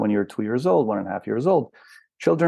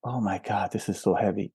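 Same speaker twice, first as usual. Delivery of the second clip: mock heavy sigh; 3 semitones lower, 6 dB quieter than usual. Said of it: "But this is not a".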